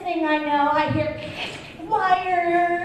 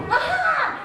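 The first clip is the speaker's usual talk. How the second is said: cat's aha; 13 semitones higher than usual. Retold or imitated. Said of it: "she'd go".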